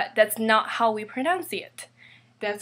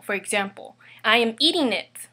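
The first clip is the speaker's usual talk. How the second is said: ordinary talk, nothing marked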